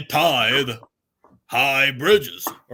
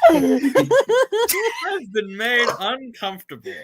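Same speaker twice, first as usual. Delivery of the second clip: laughing uncomfortable; 11 semitones higher, 3 dB louder, much slower than usual. Real, not acted. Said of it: "I'm uncomfortable".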